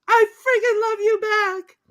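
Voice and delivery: very high, strained love cry; mock passionate reply. Fan quoting the woman answering her lover, high-pitched.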